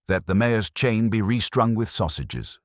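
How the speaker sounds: usual speaking voice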